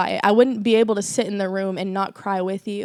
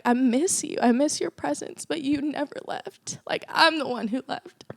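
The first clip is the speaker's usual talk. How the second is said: higher, tearful retelling; retold tearful crying; 5 semitones higher than usual. Speaker to God in grief, retold tearful confession. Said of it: "I was just crying, like".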